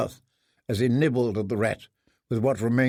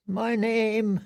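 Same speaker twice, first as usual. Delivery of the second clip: choked fear; 8 semitones higher than usual. Acted. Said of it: "a scream caught in his throat".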